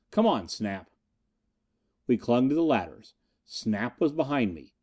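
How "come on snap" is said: ordinary delivery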